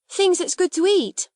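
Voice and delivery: put-on high-pitched, thrilled servant girl; great excitement. Becky marvels to Sarah about the treats, excited. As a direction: marvel eagerly at promised food. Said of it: "she broke in with such excitement".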